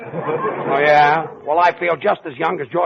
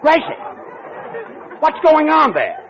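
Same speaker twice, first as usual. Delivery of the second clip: higher, raised call across the room; 8 semitones higher than usual. Real, not acted. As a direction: call her sharply to get her attention. Calling over to Gracie.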